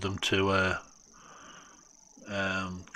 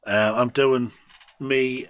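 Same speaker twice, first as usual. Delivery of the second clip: usual speaking voice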